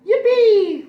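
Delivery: very high, loud yippee; joyful cheer. Acted. Narwhal cheering at the found story, squealing high. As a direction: burst out with delight.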